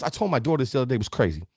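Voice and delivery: ordinary talk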